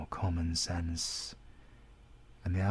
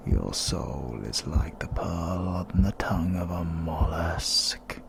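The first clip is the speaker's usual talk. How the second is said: menacing incantation; 6 semitones lower, 5 dB louder than usual. Acted. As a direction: breathe each word of the curse at the victim. Villain drains victim's life force.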